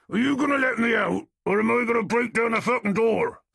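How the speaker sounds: furious, high yelling; angry shout. Acted. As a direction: bellow through the door. Locked-in man loses patience.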